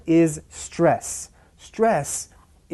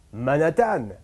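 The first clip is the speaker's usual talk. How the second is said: French-accented "Manhattan", no h, stress at the end; "ma-na-TAN" in a French accent; French pronunciation. Shown as a demonstration. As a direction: say "Manhattan" as a French speaker would. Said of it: "French people tend to pronounce this".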